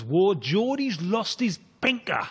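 slow, drawn-out mock Geordie; imitated Geordie accent. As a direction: recite the rhyme in a put-on Geordie. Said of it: "I had a Geordie mate once who".

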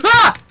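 loud high shriek of fright; real jump-scare shriek; startled scream. Real, not acted. Jump scare in horror game.

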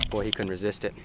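usual speaking voice

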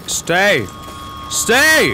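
sudden high yell; shout. Real, not acted. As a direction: yell it desperately. Begging the gauge to hold.

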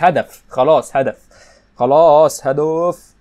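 very loud, slow stretched cry of "goal"; drawn-out shout. Real, not acted. Gamer reacting to a goal, slow loud drawn-out shout. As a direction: stretch each word out in a full yell. Goal conceded or scored, yelling.

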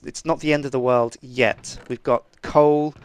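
ordinary talk

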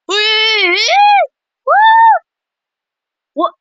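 high squealed reaction, voice shooting way up; startled disbelief. Real, not acted. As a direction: squeak it out in shock. Startled by events in game.

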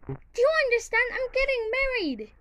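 high, loud panicked cry; panicking. Acted. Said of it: "I'm panicking!".

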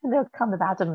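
ordinary talk, nothing marked

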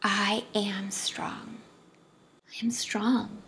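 slow, soft whisper of a mantra; a private whispered affirmation. Shown as a demonstration. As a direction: whisper it to yourself. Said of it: "You can even whisper it off of your lips".

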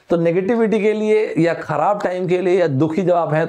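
usual speaking voice

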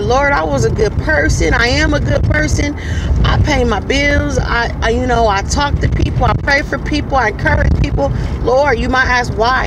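imitated believer's earnest complaint; pleading with God. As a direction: plead your case, voice lifted. Voicing a wronged believer's plea.

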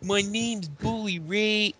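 put-on slow, stretched-out Bronx accent. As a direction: drawl it like a tough New Yorker. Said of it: "None of us could do a Bronx accent".

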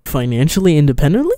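loud questioning repeat, rising sharply at the end; incredulous echo. Real, not acted. Reactor questions the streamer's botched phrase, incredulous.

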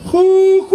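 fake whistle call. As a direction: call out like a whistle you can't do. Brother's failed whistle call. (silbido fingido con la voz)

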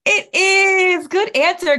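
high, loud cheer; excited. Acted. Teacher cheers the children's right guess, excited. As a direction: celebrate the right answer.

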